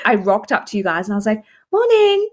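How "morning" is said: high, breezy greeting covering panic; fake casual. Breathless woman greeting her friends as if nothing happened. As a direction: act totally normal and cheery.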